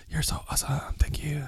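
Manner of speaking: breathy mock adoring whisper. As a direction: whisper it like a swooning fan.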